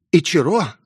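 high-pitched scared plea from hiding; frightened call. A narrator's reading. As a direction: call for help in a frightened girl's voice. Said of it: "меня окликнул испуганный голос".

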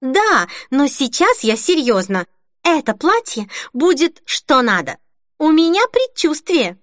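reply with bubbling excitement, pitch up; excited. A narrator's reading. Denise defending her next dress to tired friends, excited. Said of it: "возбуждённо ответила Денис".

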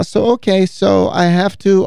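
high, careful voice for the cleaning lady; cleaning lady impression. Man quoting his cleaning lady, gentle high impression. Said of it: "I can't do a Spanish accent, but she says".

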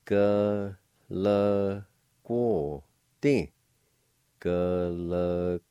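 Galagote drawn out slowly; slow model word. Shown as a demonstration. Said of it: "It's pronounced like this".

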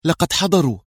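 high, anxious announcement; tense. A narrator's reading. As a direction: give the warning with nervous urgency. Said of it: "في توتر وقال".

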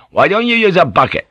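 high, loud call. A narrator's reading. Blackthorne to his drunk friend from the gateway, calling out. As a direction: call out from a distance. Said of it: "called out in Dutch from the gateway".

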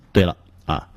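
usual speaking voice

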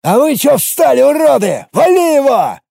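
high, loud yell at his men. A narrator's reading. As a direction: scream the order at them. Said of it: "завопил на своих людей".

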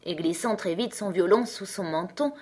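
usual speaking voice

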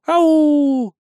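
long, high "ow" cry; high falsetto whoop. A narrator's reading. Dancer throwing in a Jackson cry, high whoop. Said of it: "кричу с яйцами в кулаке".

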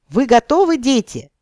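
high, loud cheerleader call; shouting. A narrator's reading. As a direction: whip up the crowd. SpongeBob chant call.

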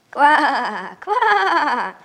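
loud laughing croak; laughing frog croak. Acted. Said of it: "засмеялась лягушка".

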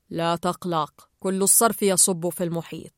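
low-pitched, calm reassurance; reassuring Gill. A narrator's reading. Gill soothes a worried tankmate, low calm reassurance. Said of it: "قال جيل".

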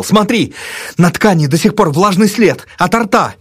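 high, excited exclamation; triumphant. A narrator's reading. Narrator shows the pillow to his friend, triumphant. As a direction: crow over the evidence. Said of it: "торжествующе бросился с добычей".

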